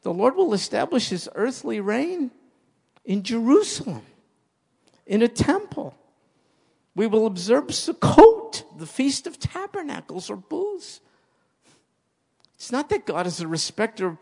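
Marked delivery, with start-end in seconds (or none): 0.0-11.0 high, ringing pulpit voice; preaching with rising fervor; fervent preaching. Acted. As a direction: proclaim it to the congregation. Preaching at full force.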